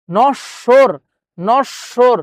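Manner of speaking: নশ্বর slowly as "nosh-shor"; doubled sh, silent b. Shown as a demonstration. Teacher testing the silent ব ফলা, syllable-by-syllable নশ্বর. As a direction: split নশ্বর on a held "sh", no b. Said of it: "ব ফলার উচ্চারণ হচ্ছে না".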